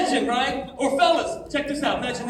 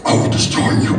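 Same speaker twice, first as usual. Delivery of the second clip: loud demonic rasp without clear pitch; hammed-up possessed child threat; possessed-girl voice; 8 dB louder than usual. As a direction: growl it like a movie demon. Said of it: "and her head spins around".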